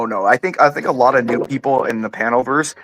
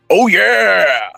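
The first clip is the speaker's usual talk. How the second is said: high, loud Kool-Aid Man shout; Kool-Aid Man yell; 6 semitones higher, 5 dB louder than usual. Retold or imitated. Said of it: "the high C Kool-Aid man".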